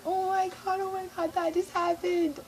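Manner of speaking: high, softish stunned squeal; giddy retold disbelief; awestruck fangirl reaction. Fans' reaction after he leaves.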